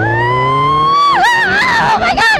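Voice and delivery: high, loud shriek; terrified scream. Real, not acted. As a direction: scream in real fright. Scared passenger on fast bike.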